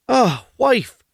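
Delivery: husband gently pleading with his wife. Acted. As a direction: coax her gently as the meek husband.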